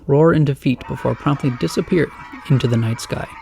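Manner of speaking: ordinary delivery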